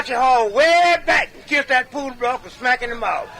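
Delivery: usual speaking voice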